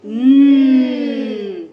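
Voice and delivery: "न" held long and loud; drawn-out n sound. Shown as a demonstration. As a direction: sustain "न" for the class. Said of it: "तो एन का sound क्या है?".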